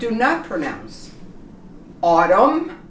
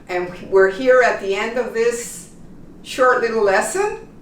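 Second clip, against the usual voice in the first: normal talking voice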